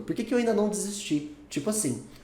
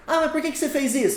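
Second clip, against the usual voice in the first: higher, quick challenging question; acted question; 9 semitones higher, faster than usual. Acted friend challenging why, higher and quick. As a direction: challenge someone on why they did it. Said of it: "Você fala".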